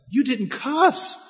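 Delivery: high, slow, marvelling repeat; amazed. Retold or imitated. Coworker marvels the preacher didn't swear: high, slow amazement. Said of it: "he was amazed about what".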